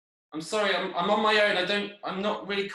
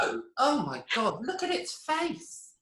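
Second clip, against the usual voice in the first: slow, much higher cooing over a cute face; cooing at the cat; 6 semitones higher, slower than usual. Acted. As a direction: gush at it like baby talk. Gushing over the cat's face.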